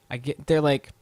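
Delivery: ordinary delivery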